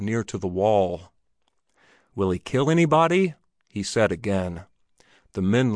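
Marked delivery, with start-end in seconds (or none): 2.1-3.3 nervous repeat. Acted. Drummer asks again, nervous.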